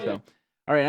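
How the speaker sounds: ordinary delivery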